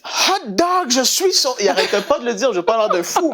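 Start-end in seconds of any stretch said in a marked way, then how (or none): 0.0-1.6 high, happy exclamation at the breakfast table; teen at breakfast, still overjoyed; joyful high cry. Retold or imitated. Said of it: "Il dit".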